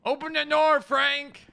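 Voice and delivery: high, drawn-out loud call. Real, not acted. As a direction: call across the lobby. Calling out to Frank.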